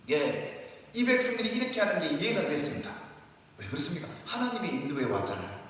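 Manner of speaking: normal talking voice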